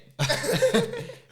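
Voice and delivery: big high laugh; real laughing fit; high burst of laughter. Real, not acted. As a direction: crack up. Laughing after real estate line.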